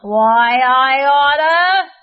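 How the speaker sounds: loud, slow, furious yell. Acted. Kareem knocked down by the jogger, loud angry yell. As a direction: threaten through clenched rage. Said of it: "yelled Cranky Kareem".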